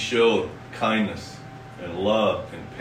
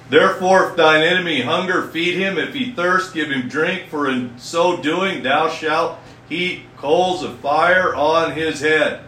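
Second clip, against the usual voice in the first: higher, louder preacher's reading with drawn-out phrases; emphatic scripture reading; 5 semitones higher, 5 dB louder than usual. Acted. Preacher reading the Bible to his congregation, emphatic scripture reading. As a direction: proclaim the verse to the congregation.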